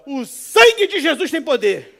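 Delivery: high, very loud, drawn-out cry; re-enacted prayer shout. Terrified driver rebuking an armed robber, loud acted prayer cry. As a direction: cry it out like a desperate comic exorcism.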